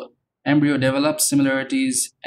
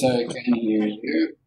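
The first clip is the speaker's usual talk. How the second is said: radio breaking up. Real, not acted. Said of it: "your voice is coming and going".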